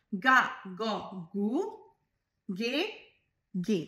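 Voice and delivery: গা, গ, গু, গে with a hard g; Italian syllable demo. Shown as a demonstration. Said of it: "গয়ের উচ্চারণে".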